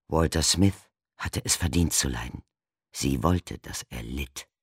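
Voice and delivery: plain reading voice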